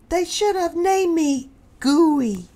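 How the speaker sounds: high, sighing little porcupine voice; a high, sorry-for-himself sigh; sighing Fluffy. Acted. Porcupine sighs over his name, high and glum. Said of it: "he sighed".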